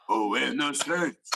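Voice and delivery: slow, drawn-out mock-deep singer; a hammy attempt at a deep voice. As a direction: imitate a deep voice, dragging the words. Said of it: "like, this deep voice".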